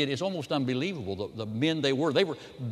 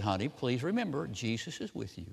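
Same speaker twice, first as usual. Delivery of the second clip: quiet soothing mother; 7 dB quieter than usual. Retold or imitated. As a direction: softly reassure a child at bedtime. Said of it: "she very quietly says to him".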